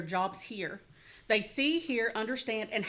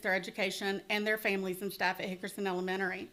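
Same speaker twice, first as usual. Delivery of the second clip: normal talking voice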